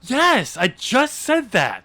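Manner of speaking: high, loud exasperated retort. Acted. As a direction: slowly drive it home to a clueless co-host. Exasperated reply to co-host's question.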